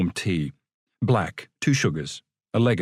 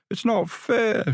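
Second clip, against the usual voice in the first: complaining voice; 11 semitones higher, slower than usual. Acted. Muttered complaints from neighbours.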